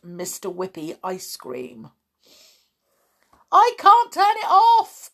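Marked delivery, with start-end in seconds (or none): 3.5-5.1 high, loud yell; panicked cry. A narrator's reading. George with runaway extinguisher, crying out. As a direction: cry out helplessly. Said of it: "cried George".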